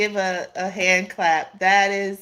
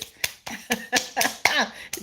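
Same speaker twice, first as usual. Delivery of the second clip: quiet laugh; 5 semitones higher, 5 dB quieter than usual. Real, not acted.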